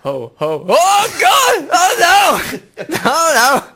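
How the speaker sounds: very high, loud panicked shouting; hammed-up cries of horror; panicking Father Christmas. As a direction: wail as if stuck dangling in mid-air. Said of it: "Imagine that, like".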